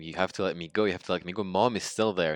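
loud, pleading cry to be let go; frantic plea to get back to mom; desperate begging. Retold or imitated. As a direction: beg him, voice rising and loud. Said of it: "I kept begging him".

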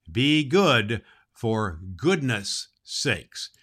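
each word drawn out, very slow; slow motto. Acted. Santa signs off to the children, slow and deliberate. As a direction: lay down the motto slowly and firmly. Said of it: "remember that Santa Claus says".

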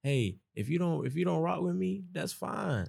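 high, airy mock-confident shrug; carefree brush-off. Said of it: "They felt like".

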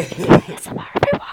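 breathy ASMR whisper; mock ASMR whisper into the mic.

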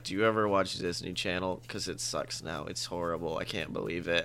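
put-on low mopey kid voice; imitating Bud Bud. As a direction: drone it like a deadpan teen. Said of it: "And he's just like".

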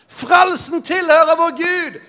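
high, slow, ringing proclamation; drawn-out heavenly shout, high and slow; proclaiming shout. Acted. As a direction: cry it out like the multitude. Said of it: "De ropte med høy røst og sa".

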